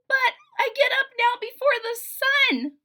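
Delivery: put-on high, loud girl's protest; protesting daughter. Daughter protesting to her father, high and loud. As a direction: push back, exasperated.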